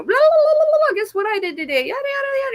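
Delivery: put-on squeaky, very high babble of kids; excited chattering kids. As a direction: jabber like a mob of excited children. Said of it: "like a group of children running at you".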